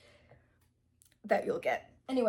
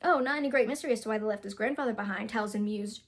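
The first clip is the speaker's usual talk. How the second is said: fast rewrite reading; faster than usual. Shown as a demonstration. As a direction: rattle off the fixed version fast. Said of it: "it should have been more of".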